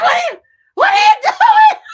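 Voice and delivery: shouting at Candace in total disbelief; disbelieving shout. Retold or imitated. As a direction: scream it like you can't believe your ears. Retold outburst repeated.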